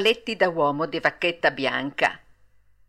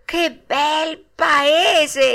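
high, very slow, loud marvelling; enraptured exclamation; 8 semitones higher, 5 dB louder, much slower than usual. A narrator's reading. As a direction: savour it with rising wonder, stretched out. Longing for the dreamed land.

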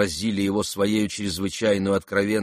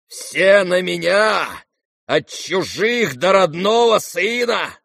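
high, loud, drawn-out denunciation; slow, ranting outrage; enraged tirade; 10 semitones higher, 6 dB louder, slower than usual. Acted. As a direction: rail bitterly at everyone, word by word. Enraged general's tirade continues.

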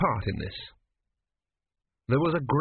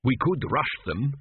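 uncertain; slower than usual. Acted. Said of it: "said Tepic, uncertainly".

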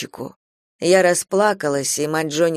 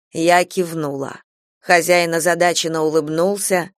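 ordinary delivery, nothing marked

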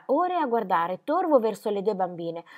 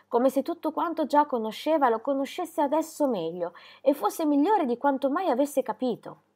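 ordinary delivery, nothing marked

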